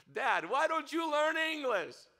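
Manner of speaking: put-on high, loud, slow childish whine on "Dad, why don't you learn English?"; a boy pleading with his father, drawn out; whiny child. Boy complains to dad about Ukrainian school, in a high loud slow whine.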